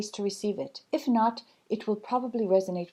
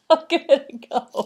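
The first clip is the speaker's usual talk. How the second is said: higher and louder, laughing through a cheery goodbye; laughing sign-off; 5 semitones higher, 4 dB louder than usual. Real, not acted. Speaker laughs while saying goodbye to viewers. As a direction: sign off bright and giggly.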